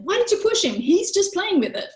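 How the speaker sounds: sharp, high, accusing parent; scolding parent. Acted. Parent scolding a toddler who pushed, high and sharp. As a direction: snap at the child as if they did wrong. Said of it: "we're kind of attacking them".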